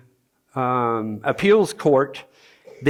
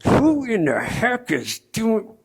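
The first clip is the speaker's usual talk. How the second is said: slow, higher, exasperated grumble; annoyed at the ringing phone, drawn out; higher, slow, fed-up demand; irritated outburst; 7 semitones higher, slower than usual. Retold or imitated. Phone rings at worst moment.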